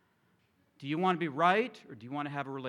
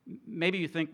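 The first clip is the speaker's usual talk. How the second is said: usual speaking voice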